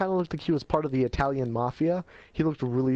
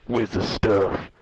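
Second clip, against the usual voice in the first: lower, louder, slow thug voice; menacing thug; 6 semitones lower, 4 dB louder, much slower than usual. Retold or imitated. Sketchy man demands the drugs from the kid, slow menacing low voice. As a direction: growl it out slow like a dealer. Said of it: "or no, he was like".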